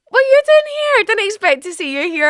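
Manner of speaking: very high, loud mock surprise; surprised parents. Parents finding their daughter at the door, high surprised squeal. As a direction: squeal it as delighted, startled parents.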